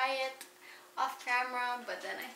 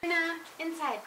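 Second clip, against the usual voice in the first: coaxing; 6 semitones higher than usual. Real, not acted. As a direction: coax the dog in gently. Calling the dog, nearer and softer.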